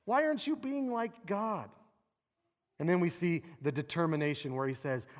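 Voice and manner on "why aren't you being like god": much higher, pleading cry to God; high, bewildered plea to God; anguished questioning. Acted. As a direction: voice Asaph demanding answers from God, pitched way up. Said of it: "but he's questioning".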